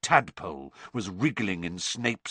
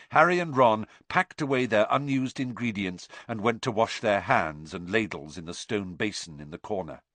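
ordinary delivery, nothing marked

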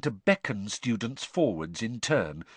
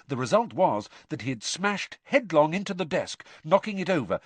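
usual speaking voice

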